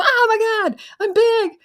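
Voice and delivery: very high, loud astonished cry; overwhelmed realization. Retold or imitated. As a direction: gasp at your own greatness. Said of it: "And they go".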